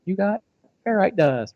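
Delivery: retold pretend-play reward for a kid; playing along with child. Playing along with daughter's pretend game.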